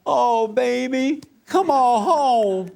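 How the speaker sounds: high, slow, tender mother's coaxing; mama's soothing voice. Retold or imitated. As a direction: coo like a doting mama. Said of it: "Mama would have said".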